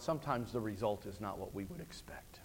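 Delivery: soft whispered aside. Real, not acted. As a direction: draw them in with a hush. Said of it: "sermon turns hushed".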